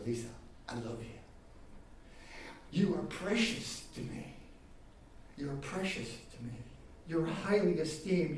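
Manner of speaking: God's tender voice. Retold or imitated. God tenderly telling each listener they are loved. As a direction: speak each name gently, like a loving father. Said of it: "God says".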